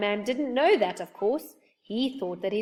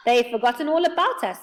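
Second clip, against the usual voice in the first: mildly joyful, louder line; 3 semitones higher, 7 dB louder than usual. A narrator's reading. Joe seeing the pair chatting away, mildly joyful. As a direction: announce it with a touch of glee. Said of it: "said Joe joyfully".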